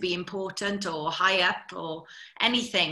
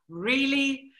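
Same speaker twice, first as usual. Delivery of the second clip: drawn-out, higher-pitched incredulous question; mock disbelief; 5 semitones higher than usual. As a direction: imitate an astonished crew. Crew's amazed reaction, acted.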